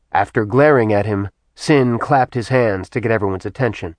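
ordinary delivery

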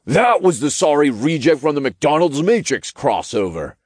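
high, cheeky little character voice; Todd interjecting. A narrator's reading. Little sidekick blames someone else, high-voiced interjection. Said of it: "Todd interjected".

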